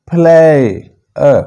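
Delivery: फ्लाई drawn out, then a separate अ; correct, in parts. Shown as a demonstration. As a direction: puff the p, split फ्लाई from अ. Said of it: "तो प्ले नहीं".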